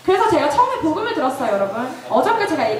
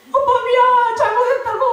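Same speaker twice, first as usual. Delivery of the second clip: high, loud mock pleading; retold begging; 9 semitones higher, 4 dB louder than usual. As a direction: beg dad for mercy, hammed-up. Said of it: "막 빌었죠". (다급하게 싹싹 비는 아이 흉내)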